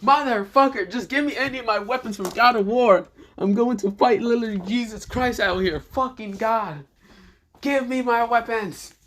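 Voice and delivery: high-pitched ranting demand; furious yelling. Acted. Hero demanding weapons to fight God, high furious yell. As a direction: rage at the heavens, voice pushed way up. Same character rages for weapons.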